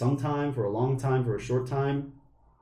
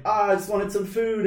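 mock bear voice; 9 semitones higher than usual. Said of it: "he's just like".

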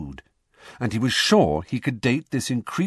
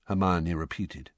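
ordinary delivery, nothing marked

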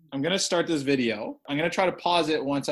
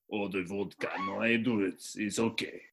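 lower, softer mock Russian accent; put-on foreign accent; 7 semitones lower, 7 dB quieter than usual. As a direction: wave it off quietly in a fake accent. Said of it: "Can you guess that accent?".

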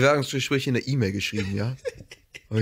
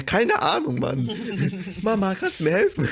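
high, laughing call for mum; helplessly laughing, asking mum about the family illness form; laughing helpless plea; 7 semitones higher than usual. Retold or imitated. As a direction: giggle through the plea for help. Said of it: "Ich so".

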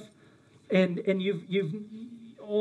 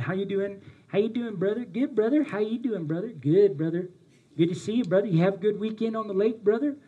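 put-on Southern churchgoer greeting; lilting Southern small talk, brother to brother; Southern accent. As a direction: play a glad-handing Southern brother. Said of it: "I'm using a Southern accent".

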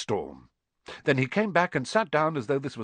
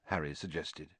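usual speaking voice

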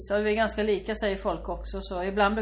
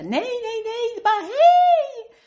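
very high, slow, loud gushing cry; overexcited fan squealing at the sister; 13 semitones higher, 8 dB louder, slower than usual. Retold or imitated. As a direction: insist with a giddy high squeal. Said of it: "fan insists, squealing".

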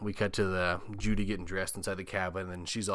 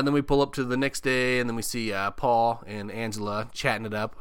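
ordinary talk, nothing marked

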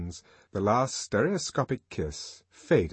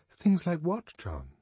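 puzzled woman voice; 9 semitones higher than usual. Acted. Lenina puzzled question.

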